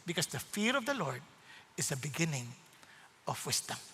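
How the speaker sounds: soft, low fatherly voice; hushed, patient reassurance with pauses; gentle counsel. Acted. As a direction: speak softly and tenderly, as to your child. God gently counselling his child, acted.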